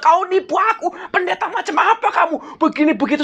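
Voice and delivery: high-pitched accusation broken by laughter; mock angry accusation. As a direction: imitate the furious buyer while laughing. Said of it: "Lalu dia marah-marah".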